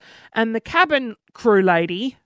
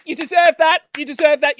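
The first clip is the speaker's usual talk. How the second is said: mock scolding; 4 semitones higher, 4 dB louder than usual. Imagined scolding of hated passenger.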